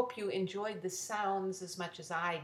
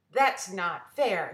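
slow, louder protest; indignant protest; 7 dB louder, slower than usual. Acted. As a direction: drag out the complaint, louder. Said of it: "said Mary".

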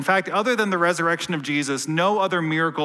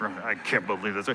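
low, soft mock grumble; grumbling under breath; 7 semitones lower, 7 dB quieter than usual. Shown as a demonstration. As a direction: mutter it like a passenger whose Wi-Fi died. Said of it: "That's what it sounds like".

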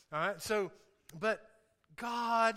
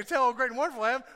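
mock bragging line; 3 semitones higher, 5 dB louder, faster than usual. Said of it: "she just has to say".

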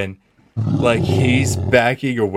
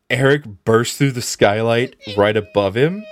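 usual speaking voice

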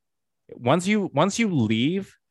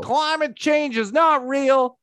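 higher, slow, dragged-out pundit proclamation; mock right-wing pundit; 11 semitones higher, slower than usual. Mocking a right-wing guest who wants a podcast spot, slow high pundit drawl. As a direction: stretch it out like a smug hot take. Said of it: "I can shit talk liberals".

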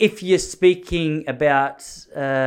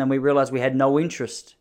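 usual speaking voice

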